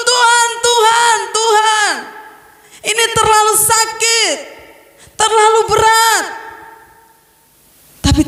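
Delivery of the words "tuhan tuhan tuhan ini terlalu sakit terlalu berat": high, slow, repeated pleading; desperate plea. Retold or imitated. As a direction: beg God, drawing out each Tuhan. Voiced desperate crying to God. (memohon dengan putus asa, suara tinggi)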